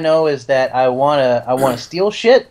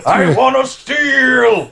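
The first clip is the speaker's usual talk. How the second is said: high, drawn-out cry to the heavens; shouting at the sky; 8 semitones higher, slower than usual. Pretend. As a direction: proclaim it skyward, slow and high. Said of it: "you're all talking in unison at the sky".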